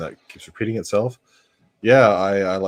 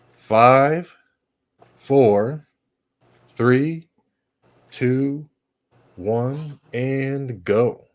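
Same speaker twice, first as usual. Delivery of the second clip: loud, higher, evenly spaced countdown; 6 semitones higher, 7 dB louder, much slower than usual. Real, not acted. Host cues viewers to click play, loud spaced countdown. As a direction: count viewers in, a beat between each number. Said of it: "I'm just gonna count backwards from five".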